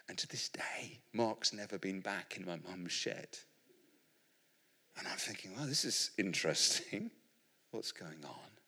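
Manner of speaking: soft, whispered close; hushed. Acted. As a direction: drop to a secret hush. Hushed ending.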